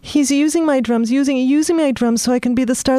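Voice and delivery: put-on high boyish bragging; excited boast. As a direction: brag about the star role. Mikey boasting.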